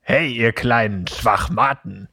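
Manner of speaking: a hint of spoiled rich brat; Draco Malfoy impression. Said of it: "ich begrüße jetzt noch mal ganz kurz so, wie ich glaube, dass Draco Malfoy begrüßen würde".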